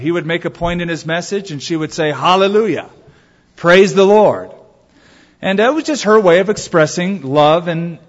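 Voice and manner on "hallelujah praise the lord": mildly excited retold exclamation; excited interjection. Betty exclaiming during the minister's message, mildly excited interjection. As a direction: call out in approval, lightly acted. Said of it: "she verbalized her excitement".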